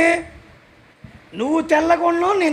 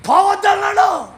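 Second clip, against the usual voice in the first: emphatic; 9 semitones higher, 9 dB louder than usual. Acted. Preacher quoting pastor's ban.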